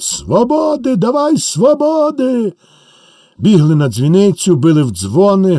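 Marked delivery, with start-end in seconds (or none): 0.0-2.5 much higher, slow, drawn-out cry; crowd demanding freedom. Acted. The crowd crying out for freedom, high and drawn out. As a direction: plead for freedom as one voice. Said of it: "гукає народ".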